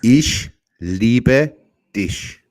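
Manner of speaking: very slow, loud German, word by word; slow language demonstration. Shown as a demonstration. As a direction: model the German phrase for a learner. Repeating the German for learner.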